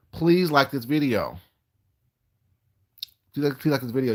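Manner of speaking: pleading. Real, not acted. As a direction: draw it out to coax viewers. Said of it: "but listen to my voice".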